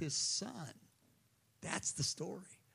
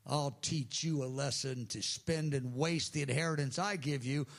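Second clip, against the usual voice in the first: ordinary talk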